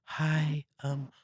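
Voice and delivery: sweet and gentle like a grandma at the door; sweet old lady. Retold or imitated. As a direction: kind elderly woman greeting a salesman. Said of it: "sweet old lady and she says".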